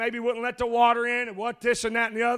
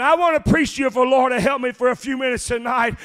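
loud, driving sermon cadence with uh breaths; preaching at full force; 2 semitones higher, 6 dB louder than usual. Acted. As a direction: preach it hard to the congregation, louder and louder.

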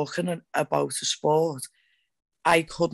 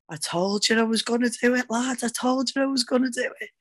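higher-pitched, excited crowing; triumphant boast; 8 semitones higher than usual. Retold or imitated. Fighter running to her boss after winning, triumphant boast. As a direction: crow it like you called it all along.

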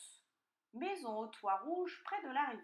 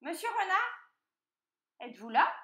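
high, loud questioning call; piglet calling out; 11 semitones higher, 11 dB louder than usual. A narrator's reading. Piglet to the fainted fox, high questioning call. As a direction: call up high, checking for an answer.

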